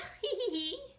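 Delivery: high little giggle. Acted. Little Deanie giggling because it tickles. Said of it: "Little Deanie giggled".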